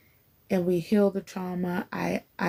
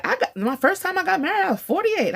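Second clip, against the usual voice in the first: put-on quoting the older wife; 11 semitones higher, faster than usual. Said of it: "and said".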